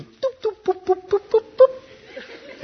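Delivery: put-on high, squeaky baby-talk cooing. Shown as a demonstration. Preacher showing how you coo at a baby. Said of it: "you tap the chin, you go".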